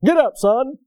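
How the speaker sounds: loud, high mock-stern order; gruff dad. Preacher imitating dad to son with broken leg, mock-stern. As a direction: bark it like an unsympathetic father. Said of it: "a dad coming along".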